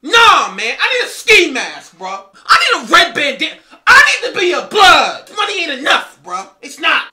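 loud, whiny demand for a ski mask; gangster wannabe voice. Pretend. Rich rapper begging his friend for gang cred, loudly whining. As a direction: beg like a rich kid who wants to be a gangster.

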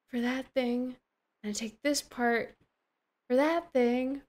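ordinary talk, nothing marked

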